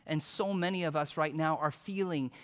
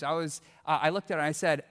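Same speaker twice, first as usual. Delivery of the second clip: ordinary talk, nothing marked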